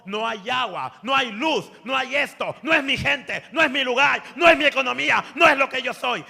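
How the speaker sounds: high, loud volley of objections; loud protest. Retold or imitated. Pastor objects to God about the new place, loud protest.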